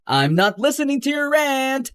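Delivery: high, sing-song refusal to listen; childish taunt. Acted. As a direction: tune them out like a bratty kid. Drowning out the other's rant.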